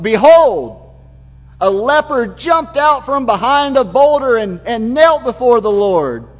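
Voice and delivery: loud, high, excited BEHOLD; storyteller springing the scene on the listeners, loud and high; dramatic telling. Shown as a demonstration. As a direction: burst out with the surprise of it. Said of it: "it's more like".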